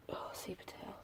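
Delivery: soft dismayed whisper. Real, not acted. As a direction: breathe it out, dismayed. Mutters over a rotten tuber.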